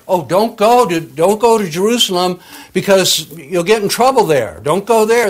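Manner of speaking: high, loud pleading voice; a prophet crying out a warning, high and loud; urgent prophet's warning. Retold or imitated. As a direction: beg him loudly not to go. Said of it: "that he's told by a prophet".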